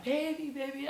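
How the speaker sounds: high, put-on cooing voice; mock lovey-dovey greeting, pitched right up; imitating boyfriend's greeting. Boyfriend greeting her at the hotel, high mock coo.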